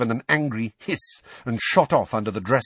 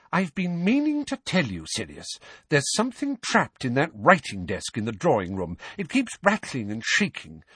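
Mrs Weasley voice; 7 semitones higher than usual. A narrator's reading. Mrs Weasley, calmer now.

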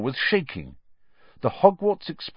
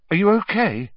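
nervous; 8 semitones higher, 6 dB louder than usual. Acted. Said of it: "Ron asked nervously".

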